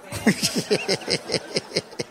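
high, breathy chuckle in bursts; real amused laugh after his own joke. Real, not acted. Preacher laughing at his own bargain with God, wheezy chuckle.

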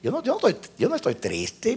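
very soft, puzzled talking to himself; hushed private questioning; inner self-talk. Retold or imitated. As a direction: think aloud under your breath, baffled. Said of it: "Ese yo entre mí".

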